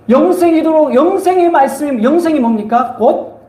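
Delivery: high, insistent sermon questioning; emphatic preaching. Real, not acted. Preacher presses question on congregation. (높은 음으로 힘주어 묻는 설교조)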